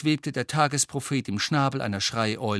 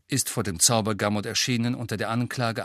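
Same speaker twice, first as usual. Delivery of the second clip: plain reading voice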